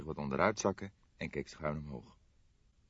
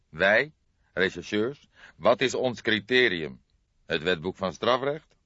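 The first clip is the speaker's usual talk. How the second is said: louder, higher exasperated questions; irritated; 4 semitones higher, 7 dB louder than usual. A narrator's reading. Older detective argues with partner about criteria, irritated. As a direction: press each question with annoyance. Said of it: "In zijn stem trilde irritatie".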